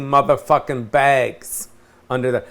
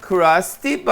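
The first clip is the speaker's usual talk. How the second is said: usual speaking voice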